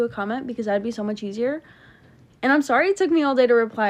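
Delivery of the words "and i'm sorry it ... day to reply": fast, louder hurried sorry; hurried, breathless apology to the viewers; rushed apology. Real, not acted. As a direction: rattle it off quickly.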